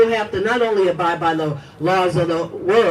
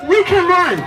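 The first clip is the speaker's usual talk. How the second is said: louder, punching WE; rallying cry; 12 semitones higher, 7 dB louder than usual. Acted. Activist to rally crowd, loud rallying cry. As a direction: call the crowd to their feet.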